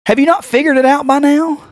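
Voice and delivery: high-pitched incredulous question; exasperated. Retold or imitated. Retold exasperated question to partner.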